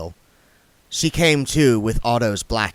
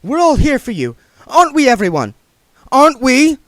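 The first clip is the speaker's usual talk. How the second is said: rallying; 8 dB louder than usual. Acted. Sacharissa rallying the dwarves.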